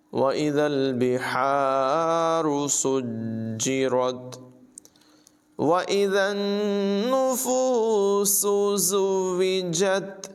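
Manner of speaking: slow chanted tajweed recitation; Quran recitation. Shown as a demonstration. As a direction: recite it melodically, drawing out the vowels. (धीमी, सुरीली क़ुरान तिलावत)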